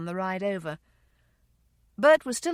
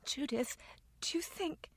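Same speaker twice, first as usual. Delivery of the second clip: high, whispered question; hushed, faltering, left hanging; hesitant whisper; 7 semitones higher, 12 dB quieter than usual. Acted. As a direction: whisper it, afraid to finish. Grieving daughter asks hesitantly.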